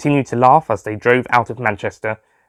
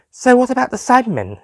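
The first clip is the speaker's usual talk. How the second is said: Lisa; 13 semitones higher than usual. A narrator's reading. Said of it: "Lisa asked".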